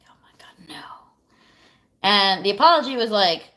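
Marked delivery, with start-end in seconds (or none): 0.1-0.8 a breathless whispered oh my God, no; whispered dread. Retold or imitated. Reading his apology message, whispered in disbelief. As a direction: hush it like you can't believe it.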